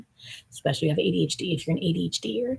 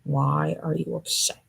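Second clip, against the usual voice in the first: ordinary talk, nothing marked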